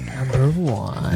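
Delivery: low, drawn-out echo of a big reveal; mock announcer echo. Co-host echoing the top spot, mock announcer. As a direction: repeat it slowly in a deep announcer voice.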